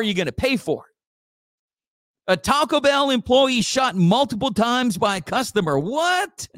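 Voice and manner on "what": high shocked what; surprised. Real, not acted. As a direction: react in disbelief. Shocked at the news.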